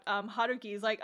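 normal talking voice

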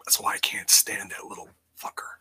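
low, hushed whisper of contempt; whispered aside. Real, not acted. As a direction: whisper the insult under your breath. Muttered insult aside.

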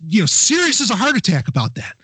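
ordinary talk, nothing marked

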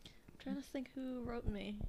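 breathy whisper. Real, not acted. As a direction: say it under your breath. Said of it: "whispered aside".

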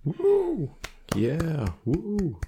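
high whooping party cheers; rowdy party animal. Acted. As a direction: whoop it up like a wild crowd. Mock cheering after the presentation.

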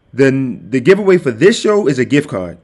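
usual speaking voice

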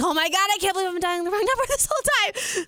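high, rushed and laughing disbelief; frantic retold outburst; panicked realization. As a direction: blurt it out fast and high, half laughing. Retells panic at wrong number.

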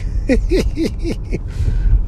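short airy chuckle; a quick unvoiced laugh at his own joke; breathy laugh. Real, not acted.

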